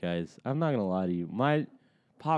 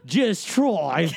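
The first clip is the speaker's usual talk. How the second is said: mocking, exaggerated British vowels; stupid-sounding put-on British accent; hammed-up British, laughing; bad British accent; 4 dB louder than usual. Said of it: "It always sounds so stupid".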